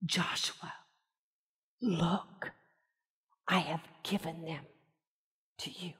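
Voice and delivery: low, hushed voice of God; God murmuring reassurance to Joshua; soft whisper. Acted. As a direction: breathe the promise softly. God speaking softly to Joshua.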